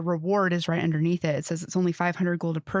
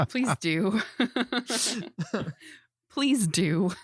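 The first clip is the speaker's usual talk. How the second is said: laughing; 3 semitones higher, much slower than usual. Real, not acted. Laughing at fan's remark.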